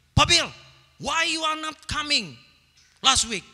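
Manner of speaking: loud, high accusing demand; high-toned demanding question. Shown as a demonstration. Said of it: "Kalau kita tanyanya gini". (bertanya dengan nada tinggi dan keras)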